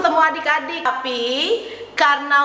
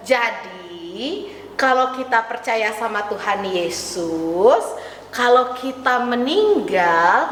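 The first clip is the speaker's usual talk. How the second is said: usual speaking voice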